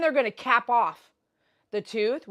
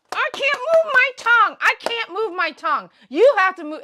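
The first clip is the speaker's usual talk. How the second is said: very high, loud protest; hammed-up shouting match; acted-out yelling; 13 semitones higher, 10 dB louder than usual. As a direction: yell it in a high, strained pitch. Acts out quarrel with assistant.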